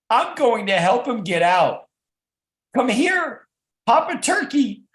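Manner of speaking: high, loud, eager mother bird calling for help; high excited mother's plea; excited Mother Turkey. Acted. Mother Turkey voiced, urging Papa.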